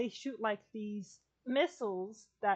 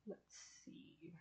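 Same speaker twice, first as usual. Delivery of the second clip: whispered to self; 15 dB quieter than usual. Real, not acted. Muttering to self while searching.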